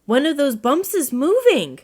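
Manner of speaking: startled, point it out in a fright; alarmed. A narrator's reading. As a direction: owl alarmed at a moving bump. Owl alarmed by moving bump.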